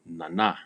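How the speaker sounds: nana stressed on the last syllable; "Nana" with weak first, strong second syllable. Shown as a demonstration. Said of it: "stress or the accent is placed on the second".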